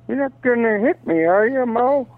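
high-pitched pleading Stooge voice; Joe DeRita impression. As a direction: put on a high, comic Stooge whine. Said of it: "I'm waiting for the Joe DeRita".

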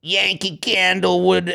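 put-on slow thick Boston accent. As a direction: read it like a Southie guy. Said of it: "I'll read it in a Boston accent".

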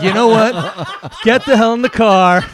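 loud, slow, fed-up snap; exasperated outburst. Retold or imitated. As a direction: finally lose patience with the kid. Exasperated father snaps at son.

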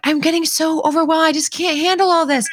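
put-on a touch of a kid near tears; overwhelmed daughter. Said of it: "she'll even say sometimes".

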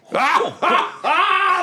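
big high hearty guffaw; booming laugh. Acted. Hero laughs at his own greeting, booming. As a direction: roar with laughter like a swaggering hero.

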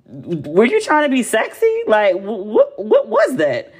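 drawn-out incredulous reaction; baffled disbelief. Retold or imitated. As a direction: stammer it out, appalled and baffled. Baffled reaction to Charles's tampon remark.

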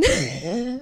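low, raspy smoker's cackle; smoker's laugh. Real, not acted. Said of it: "you just did the fucking smoker's laugh".

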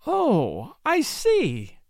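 high, thrilled exclamation; ecstatic. Acted. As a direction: gush with delight. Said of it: "she cried ecstatically".

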